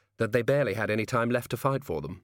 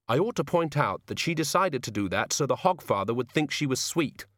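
put-on higher-pitched young woman pointing things out; 5 semitones higher than usual.

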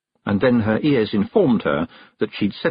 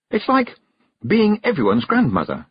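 put-on young girl voice; 12 semitones higher than usual. Tiffany speaks.

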